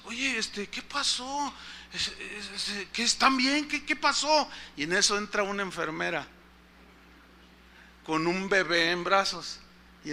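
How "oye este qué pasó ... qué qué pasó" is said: soft, stammered acted question; hesitant, bewildered asking. Visitor to the crying mother, softly bewildered. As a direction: ask softly, fumbling for words, pitch lifting. Bewildered husband's friend asks at bedside.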